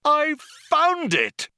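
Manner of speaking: very high, loud, drawn-out yell; triumphant cry. A narrator's reading. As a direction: shriek the discovery in triumph. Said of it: "cried Zaphod".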